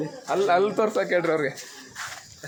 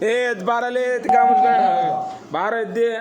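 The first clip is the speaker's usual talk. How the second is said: higher, loud wake-up call; loud call to rouse a sleeping friend; 7 semitones higher, 4 dB louder than usual. Real, not acted. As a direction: shout at him to get up.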